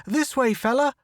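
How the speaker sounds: high urgent call. Acted. Photographer guiding partner to cave, high urgent call. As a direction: wave him in with your voice.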